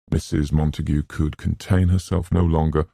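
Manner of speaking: ordinary delivery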